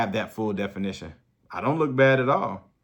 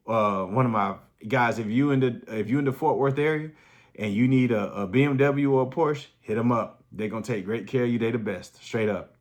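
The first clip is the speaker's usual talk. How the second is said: normal talking voice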